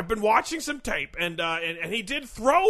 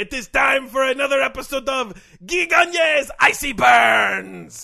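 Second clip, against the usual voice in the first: loud French host announcing his segment; French accent; 4 dB louder than usual. Acted.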